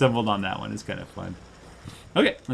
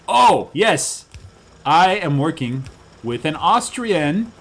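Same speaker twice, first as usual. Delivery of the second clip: louder, much higher and slow excited lead-in; 7 semitones higher, 11 dB louder, much slower than usual. Real, not acted. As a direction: announce it with a big build-up, slowly. Excited announcement.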